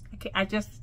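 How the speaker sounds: usual speaking voice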